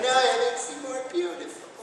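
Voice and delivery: very high-pitched teasing reassurance; mock sweet-talk. Boyfriend reassuring teased girlfriend, high mock voice. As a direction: coo it high, as a joke. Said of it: "I'm like".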